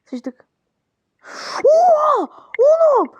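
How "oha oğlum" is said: high, loud excited yell; excited shout. Real, not acted. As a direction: shout with amazement. A great fake pulls off. (yüksek sesle heyecanlı bağırış)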